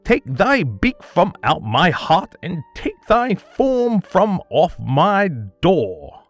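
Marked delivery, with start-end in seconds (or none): none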